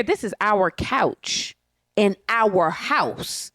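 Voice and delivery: each word laid down slowly; slow and deliberate on this and house; slow emphasis. Real, not acted. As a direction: spell it out word by word, unhurried. Emphatic point about her own home.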